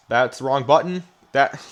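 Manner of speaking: ordinary talk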